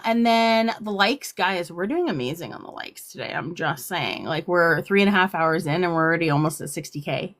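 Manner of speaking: ordinary talk, nothing marked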